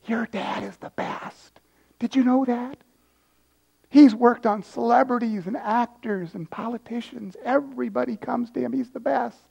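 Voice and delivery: hushed, confiding stage whisper. Acted. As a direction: whisper it as the nurse to a child. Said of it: "and she whispers".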